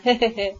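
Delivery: short, self-excusing chuckle. A narrator's reading. Writer excusing his article to the prince, chuckling.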